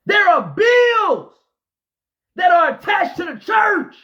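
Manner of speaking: loud, high preacher shout; whooping sermon climax; full-force preaching. Real, not acted. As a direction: preach it to the back row.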